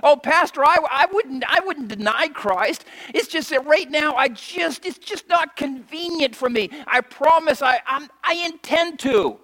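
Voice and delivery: high, whiny pleading excuse; a procrastinator pleading with the pastor, whiny. Retold or imitated. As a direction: make lame excuses to the pastor. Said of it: "You can say".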